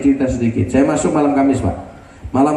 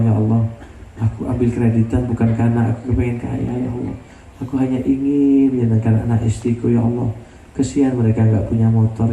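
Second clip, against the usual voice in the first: humble prayer; 4 semitones lower than usual. Acted. A husband's tearful prayer.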